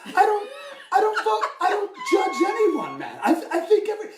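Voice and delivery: very high, loud, strained protest; hammed-up impression, pushed nearly to a shout; Tom Henry. Tom asked about a jerk musician, high evasive outburst. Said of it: "And he's like".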